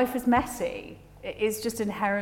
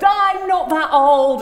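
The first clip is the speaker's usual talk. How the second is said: indignant mum; 8 semitones higher, 10 dB louder, slower than usual. Retold or imitated.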